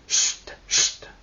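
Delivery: whispered shushes; two separate hisses for silence; hushing. Acted. Guests silence each other before the music, hushing. Everyone hushes for the trio.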